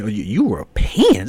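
slow, stretched disbelief; can't believe that word; incredulous. Real, not acted. Reacting to his brother saying Europeans, slow and incredulous.

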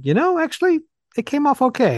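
high-pitched imitation. Quoting colleague's earlier remark.

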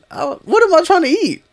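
very high, loud indignant squeak. Acted. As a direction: squeal it in mock outrage. Indignant denial.

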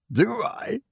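high, startled exclamation; exclaiming. A narrator's reading. Officer to Wolfgang, shocked and high. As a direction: throw it back in shock. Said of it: "exclaimed the officer".

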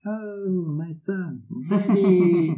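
lightly voiced cooing clown; clown dad voice. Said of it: "same face with the red nose".

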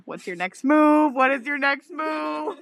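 high, drawn-out retold question; mock urgent demand. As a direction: press a friend, stretching each word. Retold demand to friend while flirting.

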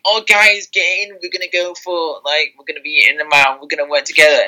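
mock chummy, irritating enthusiasm; annoying team-player. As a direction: lightly mimic an over-friendly guy rallying everyone. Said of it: "it's kind of a bit annoying".

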